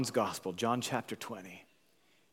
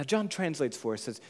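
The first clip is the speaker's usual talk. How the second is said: ordinary talk, nothing marked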